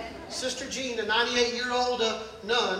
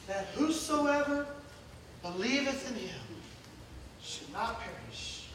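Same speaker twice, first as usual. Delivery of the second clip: slow, fading, lower reverent verse; soft scripture recitation; 6 semitones lower, 11 dB quieter than usual. Acted. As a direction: let each word fall away to a breath. Reciting John 3:16 softly.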